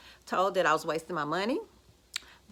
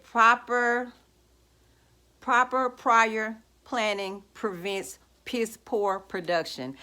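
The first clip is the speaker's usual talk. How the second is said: army saying; 2 semitones higher, slower than usual. Retold or imitated. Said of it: "what did my friend say".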